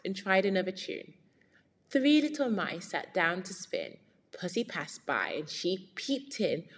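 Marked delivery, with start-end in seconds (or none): none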